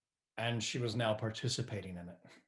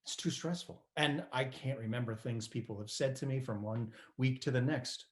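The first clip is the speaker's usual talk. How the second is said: plain reading voice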